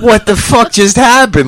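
stunned disbelief. Retold or imitated. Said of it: "I was like".

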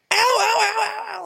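very high whimpered ows; mock kid crying ow. Dad imitating his stepson after a flick, very high. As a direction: squeal it like a hurt little boy. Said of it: "he just starts".

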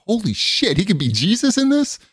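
put-on a kid suddenly getting the metaphor; stunned realisation.

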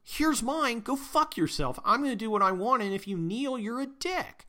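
mock tough guy telling him off; defiant retort. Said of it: "the guy goes".

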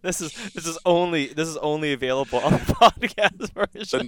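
words bubbling with suppressed laughter; barely holding in the laughter; stifled giggles. Real, not acted. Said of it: "I'm trying not to laugh".

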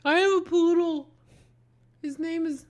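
a touch of a dainty poodle owner; lightly voiced soldier. Russian soldier describes his poodle.

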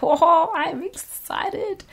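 high, slow, stretched-out gush of excitement; mock excited. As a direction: drag it out like a starstruck fan. Quoting her own reaction.

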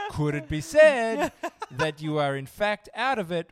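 high, loud, slow sage pondering; mock-wise rabbi musing aloud. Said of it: "Very good, Rabbi".

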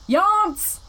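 high, loud mock yell of yonce; dad's proclamation. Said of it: "my dad just starts going".

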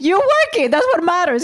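lightly voiced manager, pitched high; mock manager voice. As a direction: toss his line off in a high, teasing voice. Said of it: "He's like".

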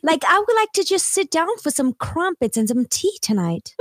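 hammed-up English accent. Shown as a demonstration. Friend answering which accents they can do, playful English accent. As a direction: put on a polite English accent. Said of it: "doing the English accent".

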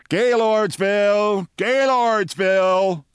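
high, slow bawled station call; bawling brakeman. A narrator's reading. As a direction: bawl the stop name long. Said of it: "bawled the brakeman".

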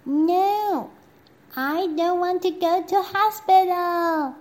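put-on high, loud childish wail; princess refusing. Princess refusing the prime minister, high loud wail. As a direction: wail it like a stubborn little girl. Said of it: "said the princess".

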